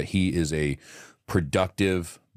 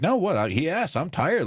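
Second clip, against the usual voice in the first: put-on higher-pitched kid insisting; mock protest; 10 semitones higher than usual. Dad imitating son defending his joke, mock protest. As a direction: play the boy's protest, pitched up.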